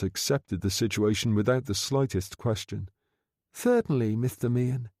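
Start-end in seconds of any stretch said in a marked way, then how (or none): 3.5-4.9 put-on lisping young man. A narrator's reading. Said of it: "He spoke with a slight lisp".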